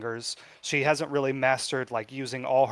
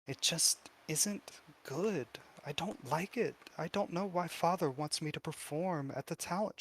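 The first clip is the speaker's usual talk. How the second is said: put-on soft, higher girl's voice, worried; small hushed young voice, growing higher and upset; troubled girl confiding; 5 semitones higher, 6 dB quieter than usual. Girl confiding fears about her father, soft and upset. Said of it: "she goes ... begins crying again".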